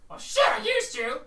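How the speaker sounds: muttering kobold. Acted. Kobold behind the door grumbles after the bottle breaks.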